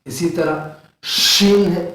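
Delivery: شین drawn out slowly and clearly; slow letter demo. Shown as a demonstration. Teacher naming the letter slowly for students, drawn out. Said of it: "شین کا مخرج یہ ہے".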